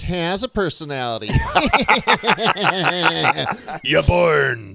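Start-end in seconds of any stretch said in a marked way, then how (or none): 1.3-3.8 big laugh. Real, not acted. Laughs at own burn.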